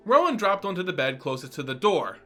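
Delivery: plain reading voice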